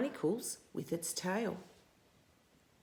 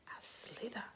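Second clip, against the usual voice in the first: hissing whisper of the word; whispered slither; 12 dB quieter than usual. A narrator's reading. Reading to a child, whispering the slither. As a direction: whisper it like sliding.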